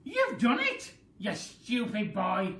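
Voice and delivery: loud, high scolding yell; furious old lady scolding the boy; Grandma shouting. Acted. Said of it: "Grandma shouted".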